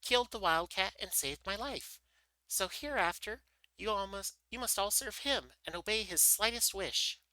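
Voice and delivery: high, commanding little mouse; mouse queen. A narrator's reading. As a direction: give orders as a tiny queen, pitched high. Mouse queen commands her mice.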